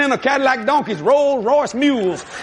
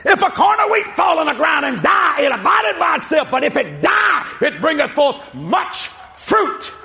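booming pronouncement; 6 semitones higher than usual. Acted. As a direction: thunder it out like a prophet. Jesus's pronouncement repeated.

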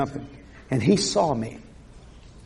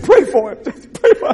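mock pleading; 8 semitones higher, 5 dB louder than usual. Quoted bystander.

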